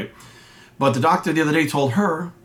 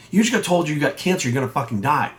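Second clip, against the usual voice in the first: normal talking voice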